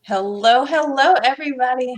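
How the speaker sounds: usual speaking voice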